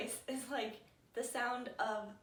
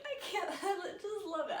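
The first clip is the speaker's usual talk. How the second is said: gushing laugh; 6 semitones higher than usual. Retold or imitated. Voicing own gushing reaction.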